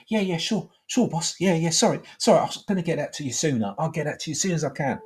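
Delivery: high, hurried apologetic underling; mock a cowed worker, starting high and speeding up; pitch jumps up. Workmate apologising to the boss, high and submissive. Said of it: "His, his pitch changes".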